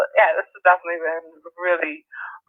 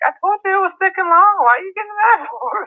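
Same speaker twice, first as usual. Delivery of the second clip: indignant retort; 13 semitones higher, 3 dB louder than usual. Retold or imitated. Arguing with braider over long hair.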